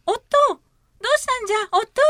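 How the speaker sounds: high frantic cries; panicked child. Acted. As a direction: call out in rising panic. Child finds father collapsed.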